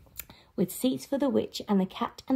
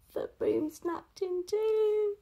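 usual speaking voice

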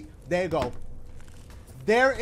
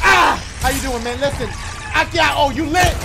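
alarm shout; 5 semitones higher, 9 dB louder than usual. Real, not acted. Enemy attacking, shouting at it.

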